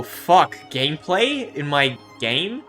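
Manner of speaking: usual speaking voice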